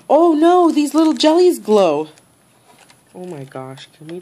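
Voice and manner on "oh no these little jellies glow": gasping in delight, high and loud; sudden thrilled discovery; surprised gasp. Real, not acted. Gasps at the jellies glowing.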